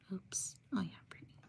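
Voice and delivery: very soft whisper to oneself; barely audible self-correction; whispered aside. Real, not acted. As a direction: whisper it under your breath. Whispered self-correction while typing.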